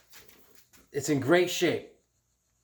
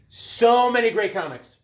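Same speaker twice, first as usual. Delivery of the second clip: "So many great comics" louder and much higher; excited emphatic repeat; 8 semitones higher, 6 dB louder than usual. Real, not acted. Collector recalling the flea market, excited louder repeat. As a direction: burst out with enthusiasm on "So many".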